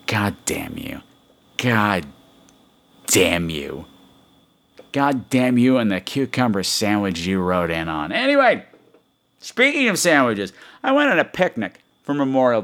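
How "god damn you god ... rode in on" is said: low, deliberate mock curse; mock cursing. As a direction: curse him in pretend bitterness, lower-pitched. Mock cursing of British author.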